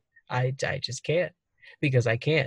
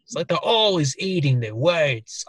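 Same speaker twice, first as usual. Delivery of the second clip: chewy attempted Aussie accent; Australian accent. Shown as a demonstration. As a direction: eat the words in an exaggerated Australian accent. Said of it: "I had to really move around my mouth a lot".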